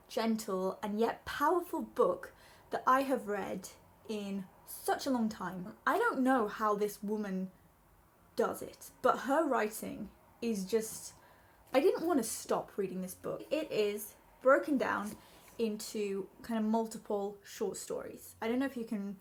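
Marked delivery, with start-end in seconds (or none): none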